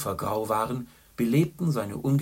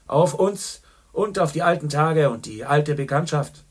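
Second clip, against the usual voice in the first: louder, merry toast; cheerful toast; 6 semitones higher, 10 dB louder than usual. A narrator's reading. Gallagher toasts old friendship, cheerfully loud. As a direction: raise the glass with gusto. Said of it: "sagte Ignatius Gallagher fröhlich".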